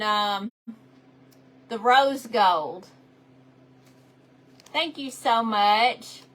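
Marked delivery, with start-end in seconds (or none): none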